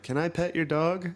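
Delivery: imitating little girl. Man retelling a girl in the park asking about his dog, high. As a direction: pipe it up like a small girl. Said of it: "was like".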